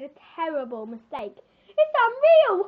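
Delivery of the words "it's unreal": slow, high, loud exclamation; a boss exploding with mock outrage; loud outburst. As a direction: belt it out in disbelief. Boss bursts out at worker.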